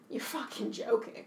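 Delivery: whispered, stunned disbelief; disbelieving whisper. Retold or imitated. Reacting to the Russian accent in a stunned whisper. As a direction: breathe it out in quiet shock. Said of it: "that I was like".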